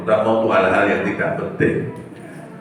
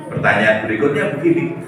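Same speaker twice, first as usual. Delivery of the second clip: ordinary talk, nothing marked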